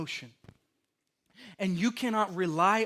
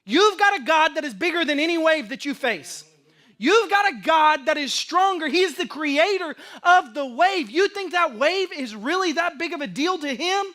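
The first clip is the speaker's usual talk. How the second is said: preaching at full force; 7 semitones higher, 7 dB louder than usual. Acted. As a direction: drive each phrase home to the congregation. Preaching climax.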